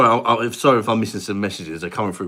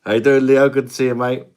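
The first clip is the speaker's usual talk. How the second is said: put-on higher, louder cheery Indian-accent greeting; Rundy's Indian accent; 5 semitones higher, 9 dB louder than usual. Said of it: "there's me alter ego, Rundy".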